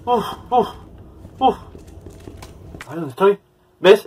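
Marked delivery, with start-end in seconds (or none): 0.0-1.6 breathy panting uf sounds; shaky exhales. Real, not acted. Player calming down after a jump scare, breathy puffs. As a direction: blow out the tension after the scare.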